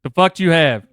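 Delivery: loud, impatient worker bark; rude yelling. Acted. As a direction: yell it at the customer. Rude drive-thru worker roleplay.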